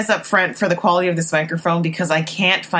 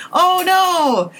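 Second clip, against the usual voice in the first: high-pitched, drawn-out dismay; 11 semitones higher, 3 dB louder than usual. Real, not acted. Viewer reacting to an accidental episode spoiler, dismayed. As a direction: wail it as if the surprise is ruined.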